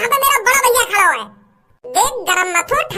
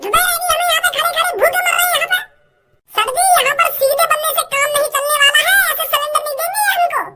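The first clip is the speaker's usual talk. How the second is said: put-on higher, sharp young woman arguing with the officials; defiant daughter voice; 5 semitones higher than usual. Daughter scolding the gas godown men, defiant higher voice. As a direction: play the fed-up daughter, shrill and cheeky.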